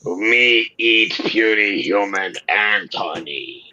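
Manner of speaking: slow, caveman-style robot dinosaur; lightly growled Dinobot talk. Pretend. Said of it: "Me Sludge".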